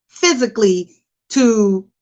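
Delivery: ordinary talk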